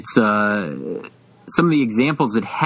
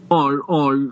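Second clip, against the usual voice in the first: put-on higher-pitched Locust Valley Lockjaw accent; Thurston Howell; 4 semitones higher than usual. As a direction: do an upper-class Long Island lockjaw accent. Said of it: "like Thurston Howell the third".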